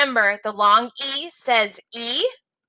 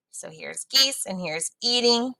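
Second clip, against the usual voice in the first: usual speaking voice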